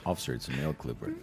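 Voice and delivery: a timid explanation to the officer; meek citizen. Pretend. Acting out a police stop.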